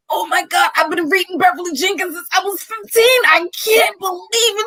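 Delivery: high, quick, gushing fangirl squeal; starstruck fan gush. Retold or imitated. Fan seeing her favorite author, high gushing squeal. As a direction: gush like you can't believe your luck.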